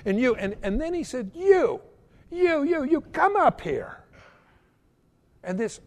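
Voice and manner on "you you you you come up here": retold calling out. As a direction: call someone out from the crowd, pitched up. Acts out man singling out people.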